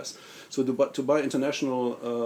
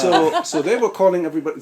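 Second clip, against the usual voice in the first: laughing; 5 semitones higher, 8 dB louder than usual. Real, not acted. Joke about free phone calls.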